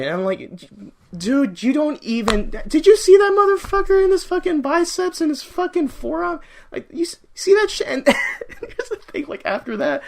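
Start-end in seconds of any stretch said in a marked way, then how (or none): 1.2-7.9 loud, high-pitched amazed rant; blurting it out in worked-up awe; hyped disbelief. Retold or imitated. Acting out his amazed reaction. 7.9-10.0 high, squeezed laughing speech; real giggles breaking up the sentence; cracking up. Real, not acted. Cracks up at his own retelling.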